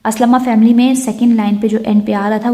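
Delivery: usual speaking voice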